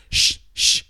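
two short hushing hisses; sharp shushing. Acted. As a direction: shush the patient to silence. Doctor hushes the patient mid-question.

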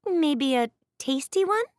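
put-on hopeful servant girl guess; venturing maid. A narrator's reading. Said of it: "Annabeth ventured".

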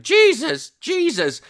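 put-on very high, loud child's cry; imitated three-year-old. Three-year-old repeating it before her horrified mum, imitated high shout. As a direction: parrot it like a small child, shrieking it out. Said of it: "This little three-year-old kid's now going".

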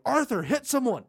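high, loud mock yell; imitated yelling. Gang member to Arthur mid bar brawl, imitated yelling. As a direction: call out like a brawler urging a friend on. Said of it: "they're yelling at you".

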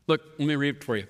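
ordinary talk

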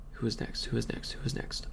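very soft, half-whispered repeated question; muttering to oneself. Real, not acted. Host working out who comes next, muttering. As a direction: think aloud under your breath.

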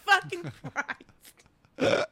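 high, helplessly amused retold disbelief; laughing exasperation. Retelling reaction to friend's bag complaint, laughing exasperation. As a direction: drag it out slowly through laughter.

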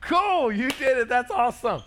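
put-on high, bright cheer for the toddler; mock enthusiastic praise. Dad cheering his two-year-old's outfit, high and excited. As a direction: pitch way up, lay on the excitement. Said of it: "I'm like".

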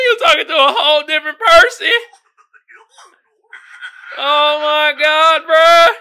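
loud, high giddy holler; cracked-up comic yell; hilarious disbelief. Pretend. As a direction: holler it while falling apart. Cracking up at the meme.

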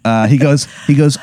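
ordinary delivery

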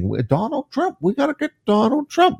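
high, soft mocking candidate voice. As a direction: parrot them in a high, silly singsong. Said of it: "all of 'em are saying the same thing".

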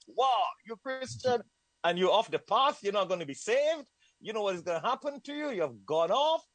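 high, mock-alarmed preacher warning; imitating missionaries. Missionaries' warning voiced in story.

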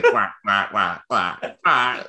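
put-on hoarse old duck quacks; sick duck voice. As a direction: quack like a sore-throated duck. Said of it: "Duck with a sore throat".

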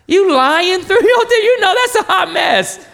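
playful laughing jab; laughing accusation. Real, not acted. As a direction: call out the fib through laughter. Teasing parents for fibbing.

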